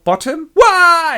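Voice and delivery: mock scream, high and loud; terrified. Actors fleeing transformed Bottom, mock terror. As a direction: let out a drawn-out comic wail.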